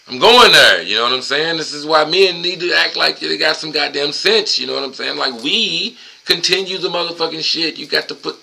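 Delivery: going off on a rant; heated rant. Real, not acted.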